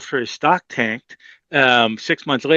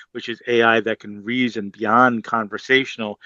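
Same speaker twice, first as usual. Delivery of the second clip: usual speaking voice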